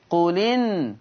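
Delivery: no glottal stop in "قل إن": qu-linn; hamza vowel transferred. Shown as a demonstration. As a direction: say "قل إن" as one word, qulin. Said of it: "بالنقل في الألفاظ الآتية".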